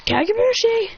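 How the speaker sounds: jutsu name yelped high; eager high yelp; excited call. Acted. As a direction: shout it out like a ninja kid.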